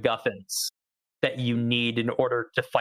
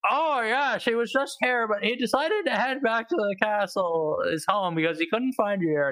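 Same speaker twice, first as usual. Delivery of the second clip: retold dad; 8 semitones higher than usual. Dad saying the prince headed home, high mock voice. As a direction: imitate a game character's dad, pitched well up. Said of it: "And he's like".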